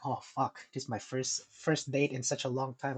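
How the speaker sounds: low, soft thinking-to-himself voice; private, hushed inner monologue; hushed anticipation. Retold or imitated. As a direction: quietly savor the moment, almost under your breath. Savoring the moment before turning.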